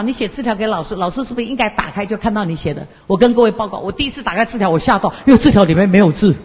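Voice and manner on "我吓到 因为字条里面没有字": usual speaking voice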